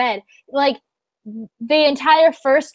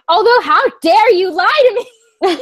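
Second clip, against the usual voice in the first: high, loud re-enacted outrage; raised-voice accusation; indignant scolding; 7 semitones higher, 7 dB louder than usual. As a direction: act out a sudden telling-off. Retold telling-off of boyfriend.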